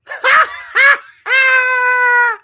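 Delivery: high, loud whooping laugh; long, high-pitched burst of laughing; loud cackle. Real, not acted. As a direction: howl with laughter. Cracks up at own mock shout.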